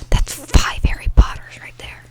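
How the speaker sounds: usual speaking voice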